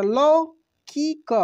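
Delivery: लौकिक stretched very slowly, each syllable apart. Shown as a demonstration. Teacher sounding out लौकिक for students, very slow. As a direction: spell out लौकिक slowly for learners. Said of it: "ल में औ की मात्रा".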